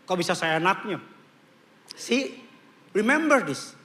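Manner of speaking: normal talking voice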